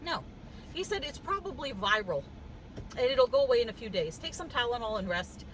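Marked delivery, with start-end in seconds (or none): none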